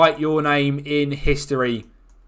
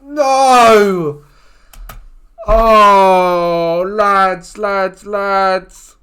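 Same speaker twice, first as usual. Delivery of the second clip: loud, high cry of despair; real anguish as the penalty goes in, loud and high; anguished shout; 5 semitones higher, 8 dB louder, much slower than usual. Real, not acted. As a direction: howl at the lads in heartbreak. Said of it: "I am devastated at that".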